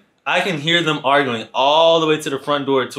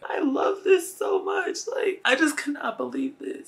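very high, softer gushing squeal; hammed-up swooning; 5 dB quieter than usual. Storyteller melting as the date kisses him, exaggerated swoon. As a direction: gush it in a tiny high voice, overcome. Swooning during a retold kiss.

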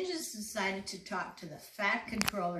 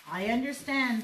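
mild sadness, higher and louder; touch of sorrow on a raised pitch; sad thought; 4 semitones higher, 7 dB louder than usual. A narrator's reading. Thomas thinking to himself at being sent away, sadly. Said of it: "thought Thomas sadly".

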